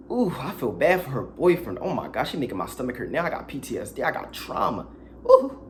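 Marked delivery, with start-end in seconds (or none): 5.3-5.6 a very high, loud ooh squeal. Real, not acted. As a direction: shriek it like a shudder. Disgusted ending exclamation.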